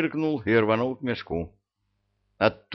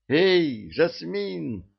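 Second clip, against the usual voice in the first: shouting; 8 semitones higher, much slower than usual. A narrator's reading. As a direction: yell her name upward. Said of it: "заорал лейтенант".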